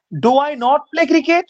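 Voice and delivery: very slow, careful model reading; slow demo. Shown as a demonstration. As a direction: spell it out word by word for the class. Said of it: "teacher models the sentence". (খুব ধীরে, স্পষ্ট করে পড়া)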